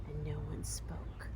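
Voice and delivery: plain reading voice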